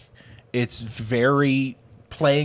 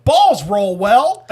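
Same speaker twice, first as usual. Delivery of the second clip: loud, high, drawn-out storybook exclamation; mock-grand slow shout; exclamation read aloud; 9 semitones higher, 11 dB louder, much slower than usual. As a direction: proclaim it slowly like a big triumphant page. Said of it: "the next page is just exclamation".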